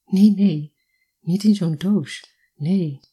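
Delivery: loud, startled "no"; startled refusal. A narrator's reading. Woman startled by a gift of strawberries, refusing loudly. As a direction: push it away with a touch of alarm. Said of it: "weerde hem geschrokken af".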